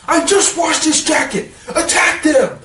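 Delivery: laughing through a high mock yell; high, laughing outburst of fake rage; mock angry yell. Villain yelling at heroes over his stained jacket, laughing mock rage. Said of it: "he gets so angry over a jacket".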